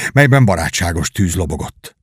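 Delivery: ordinary delivery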